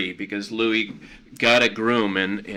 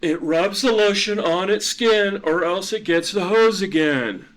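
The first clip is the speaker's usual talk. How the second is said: menacing captor; 9 semitones higher, 4 dB louder than usual. Acted. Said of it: "movie quote".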